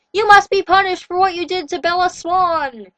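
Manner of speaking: high, loud accusing shriek. A narrator's reading. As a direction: shriek the sentence at the prisoner. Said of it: "he shrieked".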